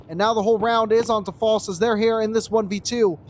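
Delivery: brisk live play-by-play call; esports caster. Acted. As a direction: call the round as it happens.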